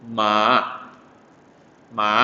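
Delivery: rising Mandarin second-tone "Ma". Shown as a demonstration. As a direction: lift each "Ma" upward like a question. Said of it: "tinggi ke nada yang paling".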